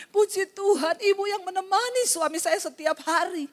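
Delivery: suara ibu terharu, lebih tinggi, setengah tertawa setengah menangis; ibu yang terharu. Retold or imitated. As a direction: tirukan ibu yang berterima kasih sambil memeluk. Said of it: "dia peluk saya". (seorang ibu di restoran berterima kasih kepada pendeta)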